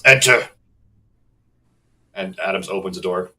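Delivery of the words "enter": gruff barked call. Acted. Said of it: "you hear a gruff voice".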